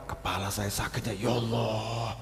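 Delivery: soft, low whining moan; moaning complaint. Acted. Complainer moaning to everyone met about a headache, softly. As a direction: groan it to anyone who'll listen.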